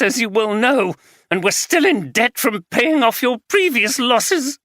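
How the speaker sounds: the betrayed wife letting rip; wife accusing husband of gambling, furious; angry wife. Acted.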